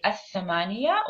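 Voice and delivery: الثمانية with the th held long, no L; الثمانية said as ath-thamaniya, L silent; doubled th. Shown as a demonstration. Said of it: "the stress here is on the letter th".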